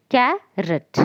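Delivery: "Carrot" broken as car, pause, rot; split into syllables. Shown as a demonstration. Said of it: "here we have two syllables".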